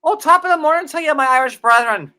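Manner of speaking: a hammed-up Irish brogue, pitched high and loud; mock Irish accent. Toasting Irish fans in a mock Irish accent. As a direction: play the stage Irishman for Saint Patrick's Day. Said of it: "I gotta work on my Irish accent".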